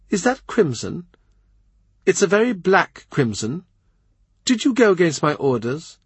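a pregnant queen fed up with visitors; hint of an irritable queen; exasperated queen. Anne voiced in story.

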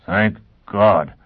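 ordinary delivery

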